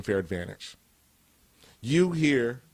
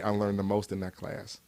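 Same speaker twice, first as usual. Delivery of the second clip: ordinary talk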